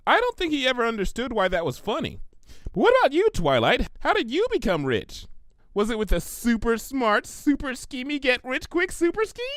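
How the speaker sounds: high, eager pony questions; bubbly pony quizzing her friend; excited pony. Acted. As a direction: pepper her with giddy questions. Narrator voices Pinkie.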